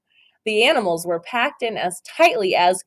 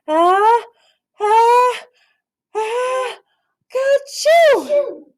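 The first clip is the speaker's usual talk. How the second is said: building ah-ah-ah rising higher into a loud ka-choo; bear's big sneeze; 4 dB louder, much slower than usual. Acted. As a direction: wind up a huge sneeze and let it burst. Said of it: "gave an enormous sneeze".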